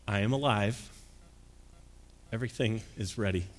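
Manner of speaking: quiet, reverent announcement to the congregation; solemn proclamation. Acted. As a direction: deliver the good news softly, letting each line settle. Soft solemn proclamation in sermon.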